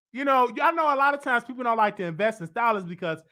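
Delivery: ordinary talk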